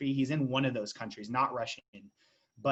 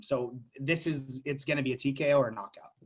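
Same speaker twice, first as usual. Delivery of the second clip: ordinary talk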